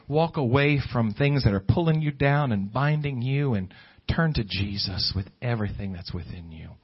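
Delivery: usual speaking voice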